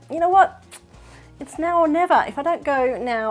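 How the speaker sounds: a sudden now-or-never decision to herself; rising excited self-pep talk that settles by the end; decisive self-talk. Retold or imitated. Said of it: "I kinda hit my thirties and I went".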